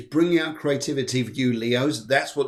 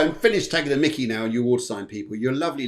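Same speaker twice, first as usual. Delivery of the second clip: ordinary delivery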